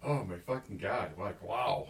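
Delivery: ordinary delivery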